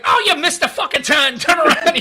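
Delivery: put-on high, loud, exasperated Scottish accent; cussing Scottish navigator. Scottish navigation scolding the driver, high loud accent. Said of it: "it's just like the cusses at you".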